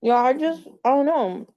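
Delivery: talking while chewing popcorn; words round a mouthful of popcorn; munching popcorn. Real, not acted. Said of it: "Sorry, I'm eating popcorn".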